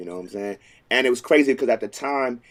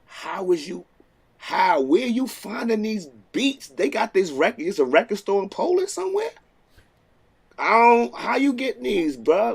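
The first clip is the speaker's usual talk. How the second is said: amazed disbelief; 8 semitones higher than usual. Retold or imitated. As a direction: ask like you can't believe the find. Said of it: "and I'm like".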